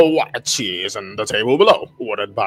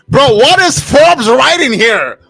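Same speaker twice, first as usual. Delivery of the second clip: incredulous outcry; 13 semitones higher, 9 dB louder, slower than usual. Real, not acted. Baffled by the article text.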